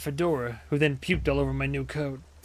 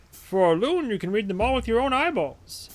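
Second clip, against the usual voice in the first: higher, unhurried news seller's patter; chipper newsie; 9 semitones higher than usual. Acted. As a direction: hail a passerby like a cheery street newsie. Voicing newsstand NPC.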